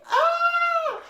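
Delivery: mock panicked shriek; hammed-up scream of fright; imitated panic scream. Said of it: "You'd think".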